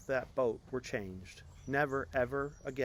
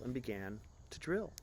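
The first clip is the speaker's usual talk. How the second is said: ordinary delivery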